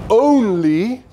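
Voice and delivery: "only" drawn out and pitched high; stress on ONLY. Retold or imitated. Preacher quoting Jesus on whom to serve, emphatic stress on "only". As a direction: lift and stretch the word "only".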